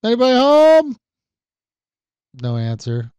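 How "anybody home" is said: high, loud call through the house; yelling upstairs. Retold or imitated. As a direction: call out loudly for anyone at home, drawing out the words. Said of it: "I'm yelling upstairs".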